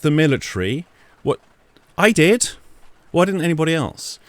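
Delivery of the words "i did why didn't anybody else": indignant. Real, not acted. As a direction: snap back, pitched up. Indignant rant.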